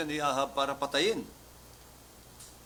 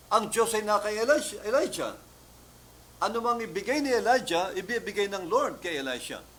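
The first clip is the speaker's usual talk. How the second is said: fervent preaching; 5 semitones higher, 4 dB louder than usual. Acted. Preaching at full force.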